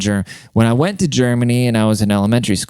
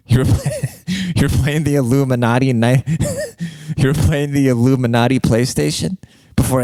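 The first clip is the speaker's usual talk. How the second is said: laughing; 4 semitones higher than usual. Real, not acted. Cracking up at own joke.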